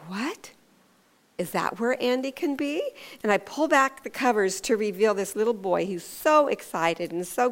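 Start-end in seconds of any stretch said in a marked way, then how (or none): 0.1-2.8 playful feigned puzzlement, rising question; mock surprise. Parent sitting on the lump in the bed, feigned surprise. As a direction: pretend you have no idea.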